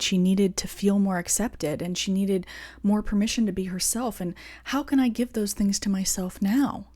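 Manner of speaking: ordinary delivery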